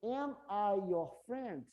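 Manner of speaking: very slow question, "am" stressed; slow model question. Shown as a demonstration. Teacher slowly models the question to students. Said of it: "I am, né?".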